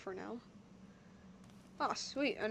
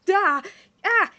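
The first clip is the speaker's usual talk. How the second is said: startled cry; 7 semitones higher, 8 dB louder than usual. Acted. Paimon startled by the robot.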